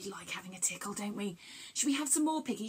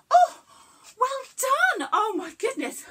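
much higher and louder, puffing after the song; excited, out of breath; breathless praise; 13 semitones higher, 8 dB louder than usual. Real, not acted. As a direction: gasp out the praise, still out of breath.

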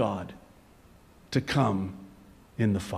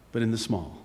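hushed contrast; 7 semitones lower, 7 dB quieter, faster than usual. Real, not acted. Preacher contrasts big with small, dropping low and soft. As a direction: lower and softer.

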